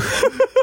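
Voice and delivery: shrill burst of laughing; short high giggle; very high squeal of laughter. Real, not acted. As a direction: crack up at the memory. Laughing retelling the story.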